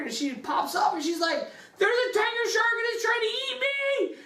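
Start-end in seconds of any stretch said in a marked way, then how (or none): 1.8-4.2 much higher, hammed-up panicked diver; mock alarm. The diver calling up to the boat crew, in high mock panic. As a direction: squeal it like a cartoon diver.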